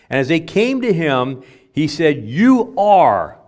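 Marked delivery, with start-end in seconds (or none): 2.3-3.4 YOU and ARE both hit loud and high; emphatic statement. Retold or imitated. Preacher quotes Jesus to his disciples, emphatic. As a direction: land hard on "You are". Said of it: "with an emphatic statement here".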